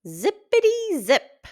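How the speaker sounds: high, loud little elf chant; playful elf. Acted. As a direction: chirp it like a busy tiny elf. Elves arrive to make shoes.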